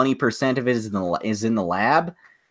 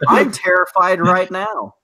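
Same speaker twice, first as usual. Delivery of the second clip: higher, hammed-up fright; mock terrified; 7 semitones higher, 3 dB louder than usual. Joking about scared men in horror, hammed-up fear. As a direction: pretend to be scared, playfully.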